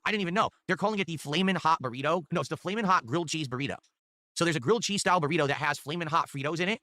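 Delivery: ordinary delivery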